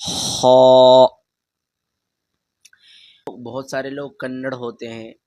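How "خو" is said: خو with a rasping throat sound, loud and drawn; throaty kh demo. Shown as a demonstration. Teacher demonstrating the khe sound for pupils. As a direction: scrape خو from the back of the throat. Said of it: "پھر دھیان سے سنیے".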